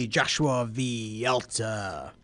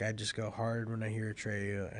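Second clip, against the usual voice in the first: usual speaking voice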